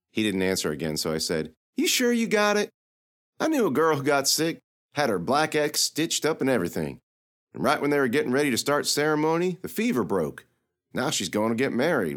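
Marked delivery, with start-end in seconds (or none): 1.8-12.2 put-on teasing girl voice, pitched high; sassy young girl. Lily needling the knight about heat, teasing. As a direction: poke fun at the knight's logic.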